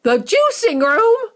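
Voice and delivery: high, slow, loud horrified cry; crying out mother. Acted. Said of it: "cried Missus Beauregard".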